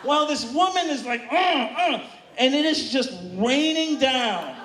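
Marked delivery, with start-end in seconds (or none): 1.3-2.0 two short mock moans; hammed-up rhythmic moaning; imitated sex grunts. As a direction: grunt twice as if in pleasure. Imitating woman's sex noises.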